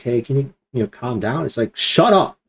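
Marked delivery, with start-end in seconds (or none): 0.0-1.5 soft, timid asking, much quieter; meek request. Retold or imitated. A meek plea to the wind, quiet and timid. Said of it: "meekly going to the wind". 1.7-2.4 a light take on Jesus ordering the storm; authoritative command. Retold or imitated. Jesus rebuking the wind, lightly voiced commanding tone. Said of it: "that authoritative command".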